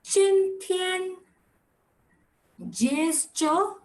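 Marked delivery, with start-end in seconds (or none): 0.0-1.2 Chin Tian with Chin raised high and level; Mandarin model word. Shown as a demonstration. Teacher modelling Chin Tian for the class, high level tone. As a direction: say Chin Tian slowly, Chin held high and flat. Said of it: "please pay attention".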